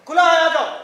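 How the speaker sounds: high, loud call. Acted. Husband telling visitor the door is open, calling out. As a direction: call out to someone outside.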